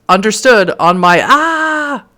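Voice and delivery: loud, then a sharp cry; sudden cry. Acted. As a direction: cut off mid-sentence with a yelp. Cop cut off by attack.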